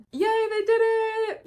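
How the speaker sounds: high, happy little cheer; bright squeal of yay. Real, not acted. Cheering the rescue in recap.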